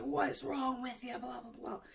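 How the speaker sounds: high-pitched mock freak-out; high, hammed-up retold panic; imitating freaked-out boyfriend. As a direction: mimic him freaking out, voice shooting up. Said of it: "freaked out".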